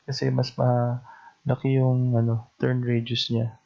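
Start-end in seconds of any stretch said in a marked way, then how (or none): none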